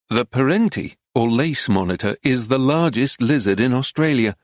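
ordinary delivery

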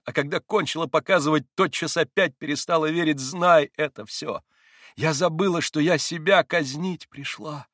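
high, loud, driving confession; frenzied outburst. A narrator's reading. Katya confesses to Mitya in a frenzied outburst. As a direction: pour it out at full pitch. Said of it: "почти бессмысленные и исступлённые".

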